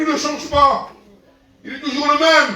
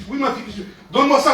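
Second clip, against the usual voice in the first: usual speaking voice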